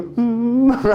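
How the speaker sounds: put-on high, held pouty hum of a child about to cry; quivering-lip whimper. Hurt little sibling deciding whether to cry, mock whimper. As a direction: whimper through a pushed-out, trembling lip. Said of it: "They get that little bottom lip quiver".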